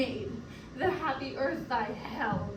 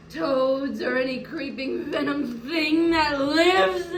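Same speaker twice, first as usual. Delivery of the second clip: swelling louder in fury; loud, bitter outburst; venomous curse; 5 dB louder than usual. Acted. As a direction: build the curse to a tearful climax. Curse builds against the killer.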